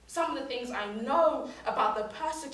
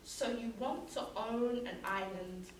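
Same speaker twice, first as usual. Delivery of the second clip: subdued after the outburst; quiet challenge; 7 dB quieter than usual. Acted. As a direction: drop to a hushed, pointed challenge. Poem returns to its opening challenge.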